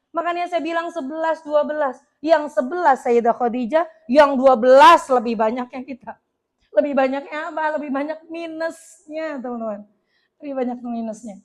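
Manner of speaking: ordinary delivery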